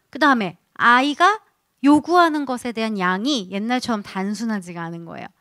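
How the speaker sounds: ordinary talk, nothing marked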